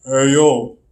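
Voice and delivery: loud, drawn-out accent impression; hammed-up wrestler's accented greeting; Scott Hall. Shown as a demonstration. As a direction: call it out big in a put-on wrestler accent. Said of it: "do this accent".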